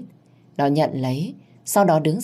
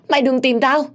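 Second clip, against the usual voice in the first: much higher and louder shrieked plea; hysterical scream; 10 semitones higher, 4 dB louder than usual. A narrator's reading. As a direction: shriek it at the peak of terror. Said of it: "ôm đầu và la hét". (gào thét kinh hãi)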